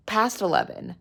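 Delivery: ordinary talk, nothing marked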